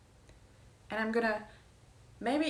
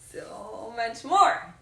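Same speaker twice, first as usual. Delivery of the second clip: loud, very slow drawn-out emphasis; slow emphatic; 8 dB louder, much slower than usual. Acted. Insisting to friend she's so much more, drawn out loud. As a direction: stretch every word out.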